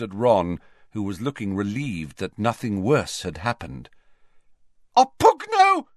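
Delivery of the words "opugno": much higher, much louder shriek. A narrator's reading. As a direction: shriek the spell. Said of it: "came a shriek".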